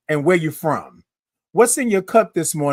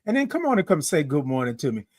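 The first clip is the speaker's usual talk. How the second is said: ordinary talk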